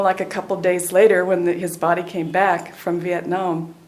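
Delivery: ordinary talk, nothing marked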